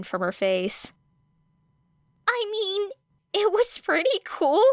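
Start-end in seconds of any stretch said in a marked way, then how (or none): 2.3-4.6 put-on much higher girlish voice, holding back a grin; light, teasing agreement; Uraraka's voice. A narrator's reading.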